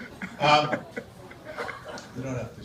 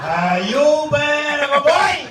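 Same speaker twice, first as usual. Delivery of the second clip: loud mock Chinese accent, pitched high at the end; Chinese-accented Santa; 11 semitones higher, 5 dB louder than usual. Comedian playing a Chinese Santa to children, Chinese accent. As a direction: stretch it out in a hammed-up Chinese accent. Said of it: "There was a Chinese Santa Claus".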